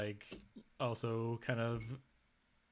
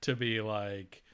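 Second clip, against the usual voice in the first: ordinary delivery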